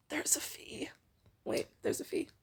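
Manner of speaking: breathy stage whisper; conspiratorial whispered aside. Pretend. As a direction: hush it like a secret.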